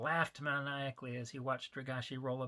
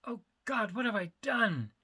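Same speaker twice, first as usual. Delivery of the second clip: despairing cry; 8 semitones higher, 5 dB louder than usual. Acted. Dealer despairs.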